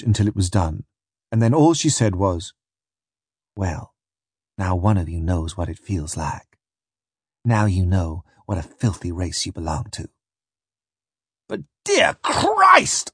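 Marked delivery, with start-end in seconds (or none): 3.6-10.1 low, soft, cold verdict; bitter contempt. Acted. Bitter woman judges his race. 11.5-13.1 high, drawn-out cry of horror; anguished exclamation. Acted. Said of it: "I exclaimed".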